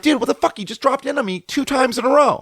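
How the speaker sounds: high-pitched indignant complaint; mad. Retold or imitated. Surfer paddling past the guy who dropped in, mad. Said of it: "Like, I'm mad".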